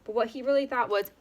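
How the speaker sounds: ordinary delivery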